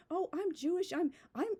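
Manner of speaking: high-pitched mocking quote; sarcastic imitation. Mimicking people who only mention it when convenient, sarcastic. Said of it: "You know, I'm being sarcastic".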